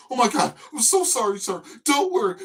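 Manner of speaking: high, loud, gushing apology; mock a frightened worker over-apologizing; panicked apology. Worker apologizing for the forgotten mustard, high loud panic. Worker voiced in retold story.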